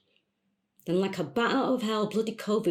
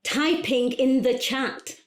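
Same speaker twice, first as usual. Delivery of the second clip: higher, slow and spaced out; slow, emphatic announcing; 4 semitones higher, slower than usual. Acted. Caller telling coach she's typing, slow and emphatic. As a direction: announce each word for someone who can't hear.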